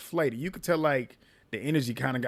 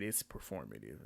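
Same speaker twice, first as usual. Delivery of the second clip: low, much quieter deadpan verdict; dry aside; 12 semitones lower, 16 dB quieter than usual. Real, not acted. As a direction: drop it flat and low under your breath. Dry comeback to the claim.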